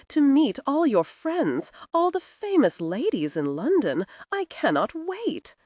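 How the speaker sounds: put-on high, gushing society girl; breathless giddy debutante; gushing young lady. A narrator's reading. Viola gushing over London.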